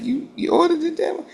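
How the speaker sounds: high, fast mock disbelief; incredulous quote. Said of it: "they was looking at me like".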